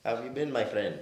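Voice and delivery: put-on loud, high Indian accent; friendly greeting in a put-on Indian accent; prank-call Indian accent. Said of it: "It was an Indian accent".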